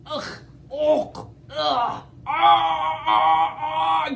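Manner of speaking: high, loud hammed-up gasps building to a sneeze; gasping. T. rex choking on feathers, loud gasps. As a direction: gasp toward a sneeze. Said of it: "gasped Tyrannosaurus".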